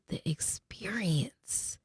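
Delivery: breathy whispered aside, slow. Real, not acted. Vlogger slipping a whispered correction to viewers, in a whisper.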